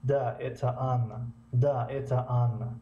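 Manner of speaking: Да stretched long and stressed, falling end; teacher drilling the stretched stressed syllable; stressed first syllable. Shown as a demonstration. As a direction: draw out Да, then drop on Анна. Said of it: "هجوی اول باید درست کشیده شود".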